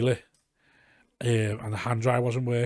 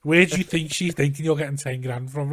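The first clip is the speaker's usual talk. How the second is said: louder, higher indignant telling-off; retold exasperated rant; 4 semitones higher, 6 dB louder than usual. Telling off his jobless cousin about the cash, exasperated. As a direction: scold him like he's daft.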